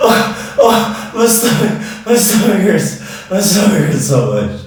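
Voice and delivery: strained from laughing fit; winded groans after laughing; after laughing hard. Real, not acted. Laughing fit, stomach hurts.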